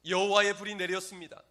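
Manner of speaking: high, loud preaching cry; triumphant proclamation. Acted. Pastor announcing God's fire has fallen, loud proclamation. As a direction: proclaim it at full voice.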